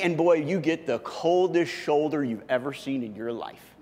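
ordinary talk, nothing marked

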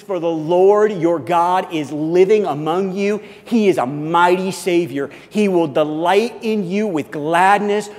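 loud, proclaiming Bible reading; scripture reading. Acted. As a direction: declare the verses to the room. Reads scripture aloud to congregation.

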